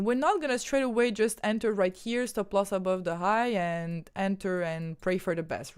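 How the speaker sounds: ordinary talk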